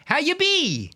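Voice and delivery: high, loud, drawn-out greeting; dad impression. Son mimics his dad's greeting, high loud stretched holler. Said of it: "no, he'd be like".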